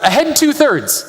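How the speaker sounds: high, slow captain's call; yelled order. Retold or imitated. Captain yelling an order to the crew, high and slow. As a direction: call the order across the deck. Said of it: "So David yells out".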